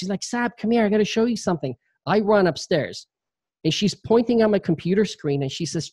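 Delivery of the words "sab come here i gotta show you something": imitating excited wife. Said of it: "I've never heard her yell my name like that".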